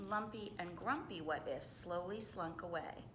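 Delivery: ordinary delivery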